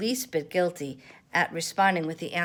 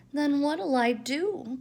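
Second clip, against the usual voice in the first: high, slow, near-tearful pipe; a small voice close to tears; piped plea; 9 semitones higher, 4 dB louder, slower than usual. A narrator's reading. Roo asking Pooh what to do, near tears. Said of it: "piped Roo".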